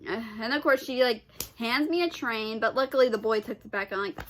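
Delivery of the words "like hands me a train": ordinary talk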